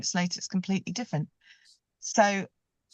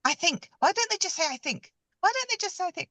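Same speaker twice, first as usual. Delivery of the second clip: exasperated; 11 semitones higher than usual. Retold or imitated. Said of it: "I just used to say to Soph".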